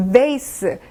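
"베이스" said as English vase with a buzzing v; V mouth demo. Shown as a demonstration. Teacher showing her mouth shape, demonstrating the v in "베이스". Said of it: "제 입 모양을 한번 보세요".

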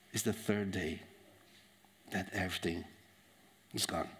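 low, hushed, with long pauses; sigh, then let each phrase drop in defeat; dejected. Acted. Voices disciples' lost hope after the crucifixion.

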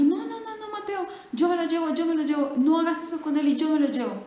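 alarmed friar begging to take the bird; pleading friar. Acted. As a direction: rush to stop him, voice up high. Friar pleads to save parrot.

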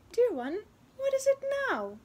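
high, light wren voice; a tiny bird asking gently; little bird voice. Acted. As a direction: magical wren greeting the girl, high voiced. The wren greets Luba.

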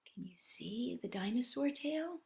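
hushed, secretive whisper; excited whisper. Acted. Teacher whispering to kids about the hidden tail. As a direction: whisper like the dino might hear.

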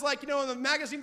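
ordinary talk

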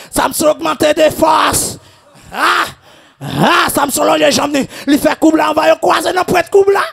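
shouted, driving sermon cadence; fiery preacher in full flow; full-force preaching. Acted. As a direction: preach it hard to the congregation. Preaching the fight, chanting saisi.

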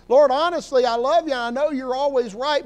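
loud heartfelt prayer; earnest prayer. Pretend. Voiced prayer, pleading to God.

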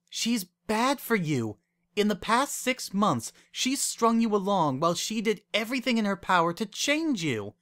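high, emphatic, exasperated argument; heated pleading. A narrator's reading. Wendy warning Lyra about her lover, heated. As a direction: build a worked-up case against her.

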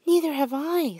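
put-on high child's reply; bright young girl; little girl voice. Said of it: "said Brooke".

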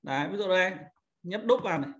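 usual speaking voice

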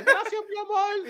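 high, slow, sugary thank-you in her voice; mock sweet woman. Quoting the odd woman thanking him, a high cooing voice. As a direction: coo it like a satisfied lover. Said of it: "y ella".

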